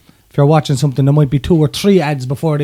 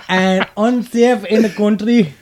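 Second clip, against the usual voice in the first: put-on Indian accent; 2 semitones higher, slower than usual. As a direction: read it in a hammy Indian accent. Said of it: "say it in an Indian accent".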